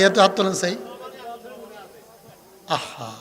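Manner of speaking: ordinary talk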